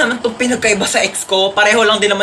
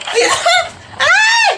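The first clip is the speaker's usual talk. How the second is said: shrill shriek with a laugh as the ride drops; roller coaster scream; much slower than usual. Acted. Rider on the coaster drop, shrieking.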